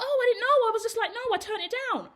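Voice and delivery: high, clueless, innocent voice; mock naive friend. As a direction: play the friend who didn't get it, wide-eyed. Friend explains missing happy ending.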